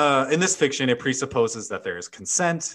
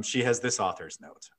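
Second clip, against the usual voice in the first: ordinary delivery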